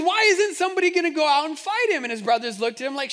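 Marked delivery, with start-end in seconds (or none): 0.0-2.0 eager outburst. Retold or imitated. David urges someone to fight.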